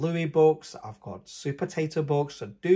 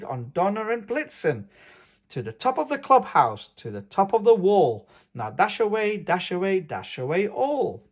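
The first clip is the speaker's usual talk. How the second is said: higher, ringing call to the team; calling the reindeer; 5 semitones higher, 5 dB louder than usual. Acted. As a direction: cheer the reindeer on like Santa. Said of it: "he whistled and shouted and called them by name".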